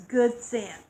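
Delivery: usual speaking voice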